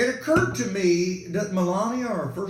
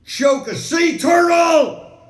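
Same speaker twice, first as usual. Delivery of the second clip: higher, louder, slow outburst; mock outrage, yelled; shouted punchline; 7 semitones higher, 8 dB louder, slower than usual. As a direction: belt the punchline out slowly.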